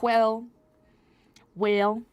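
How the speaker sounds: "Well, well" loud and stretched, mock Southern drawl; drawn-out well. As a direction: lean hard on each "well", drawled. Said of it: "I was like".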